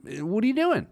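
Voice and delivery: normal talking voice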